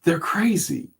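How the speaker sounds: soft, reassuring therapist voice; lightly voiced gentle therapist. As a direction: talk him down softly, like a kind therapist. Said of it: "And he was like".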